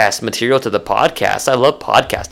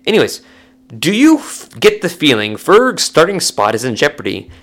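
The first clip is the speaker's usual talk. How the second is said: normal talking voice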